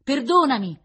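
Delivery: high, very loud cry; shouted plea. A narrator's reading. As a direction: beg forgiveness at the top of your voice. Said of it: "gridò ancora".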